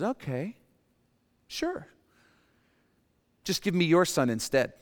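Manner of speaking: breezy, high "sure" then sly; sly kidnapper. Pretend. Kidnapper offering a trade, breezy and sly. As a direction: kidnapper casually asking for the son. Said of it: "the kidnapper says".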